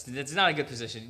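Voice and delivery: ordinary delivery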